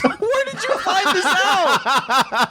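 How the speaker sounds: high, slow, laughing it out; laughing question. Real, not acted. To cohost about sexually active popes, laughing. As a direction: stretch it through the laugh.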